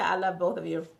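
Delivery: ordinary delivery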